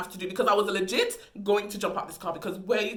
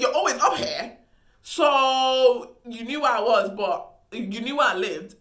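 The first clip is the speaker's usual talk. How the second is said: louder, slow, knowing disbelief; indignant realisation; 3 semitones higher, 7 dB louder, slower than usual. Retold or imitated. Thinking about the driver who faked being lost, slow indignation. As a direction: spell out the lie slowly and pointedly. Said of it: "in my head, I'm thinking".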